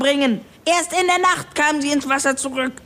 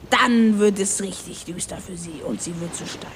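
much lower, dark tale-telling voice; ominous storytelling; 6 semitones lower than usual. Acted. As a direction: tell the curse like a grim sea legend. Klaus tells the curse tale. (düster erzählte Seemannssage)

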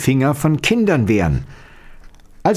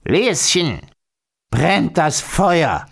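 higher, drawn-out call; old witch calling; 5 semitones higher, slower than usual. A narrator's reading. Old witch calling from bed about the fire, drawn out. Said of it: "rief die Alte abermals".

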